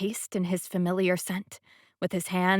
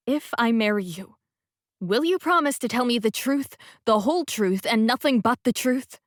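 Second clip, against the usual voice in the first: stern courtroom oath, higher and louder; like Judge Judy; 4 semitones higher, 5 dB louder than usual. A narrator's reading. Heroine to Sebastian, mock courtroom judge voice. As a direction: swear him in like a TV judge. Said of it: "sounding like Judge Judy".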